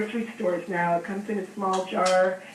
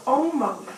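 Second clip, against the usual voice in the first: almost said higher and drawn out, with weight; stress on almost; 4 semitones higher than usual. Real, not acted. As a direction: lean hard on almost, pitched up. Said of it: "and I emphasize the word almost".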